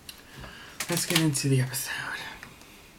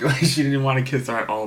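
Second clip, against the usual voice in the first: normal talking voice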